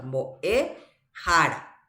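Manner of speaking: slow "Mbo'e jara", pausing between the roots; teacher's stretched demo of "Mbo'e jara"; roots shown separately. Shown as a demonstration. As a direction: draw out "Mbo'e", then let "jara" fall. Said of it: "Ahí tiene dos raíces".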